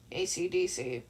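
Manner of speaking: low, soft, bored grunt of an answer; a touch of a low, bored teen; gruff Katsuki. Acted. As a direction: mutter it like you're done with life.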